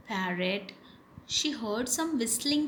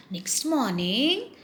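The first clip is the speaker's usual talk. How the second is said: plain reading voice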